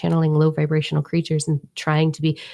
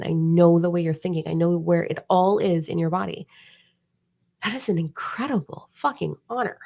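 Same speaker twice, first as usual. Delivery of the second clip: normal talking voice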